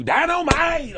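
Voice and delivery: shouted catchphrase, high and loud; J J Evans catchphrase. Retold or imitated. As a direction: yell it like a fan. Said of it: "People walking up to you".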